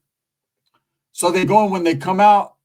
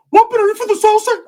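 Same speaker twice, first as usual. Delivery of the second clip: much higher, louder clueless squeal; dopey Ricky; 6 dB louder than usual. Retold or imitated. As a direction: blurt it like a high stoner. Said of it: "Ricky's like".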